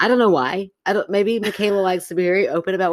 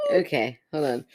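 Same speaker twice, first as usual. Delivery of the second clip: normal talking voice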